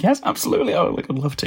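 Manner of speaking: high, eager yes, lightly voiced kid; excited kid. Tractor-mad boy accepting a prototype ride, high and eager. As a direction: squeal a delighted yes.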